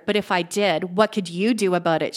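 ordinary delivery

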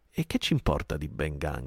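usual speaking voice

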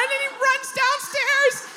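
very high, drawn-out squeal; slow, high-pitched comic hysteria; panicked shriek. Retold or imitated. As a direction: narrate while still screaming, hammed up. Keeps screaming voice while narrating.

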